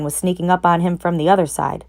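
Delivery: ordinary delivery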